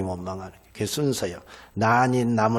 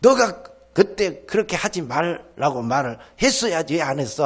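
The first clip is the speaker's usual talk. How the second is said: put-on little sister yelling at her older sister that it's her fault; blaming older sister; 5 semitones higher, 5 dB louder than usual. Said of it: "괜히 언니 책임을".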